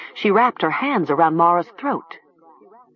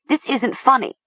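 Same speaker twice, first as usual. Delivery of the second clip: high-pitched, upset protest; distressed cry; 7 semitones higher than usual. A narrator's reading. Mara protests at Talia's threat, crying out high. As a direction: cry out in a thinner, higher voice. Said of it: "Mara cried".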